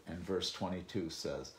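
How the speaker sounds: ordinary talk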